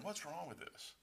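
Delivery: quiet, lightly voiced calm friend; calm Tom. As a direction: mimic Tom's calm shrug of a question. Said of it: "he's just sitting there".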